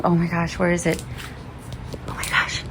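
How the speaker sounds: fading from soft to a whisper; tense real whisper while searching; hushed and wary. Real, not acted. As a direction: whisper so the lizard won't hear. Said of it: "in advance if I scream".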